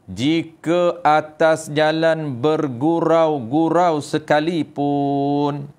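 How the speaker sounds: very slow kitab recitation; Tok Guru intonation. A narrator's reading. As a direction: hold the phrase endings long. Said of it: "intonasi Tok Guru".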